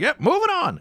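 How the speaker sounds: high, loud curt director call; brisk director. Retold or imitated. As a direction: call it fast and done. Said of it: "He's like".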